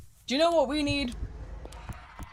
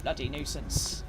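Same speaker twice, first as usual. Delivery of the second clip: low, slow muttered curse under the breath; muttered grumble; 5 semitones lower, 5 dB quieter than usual. Acted. Fed up with roadmen, muttering slowly and quietly.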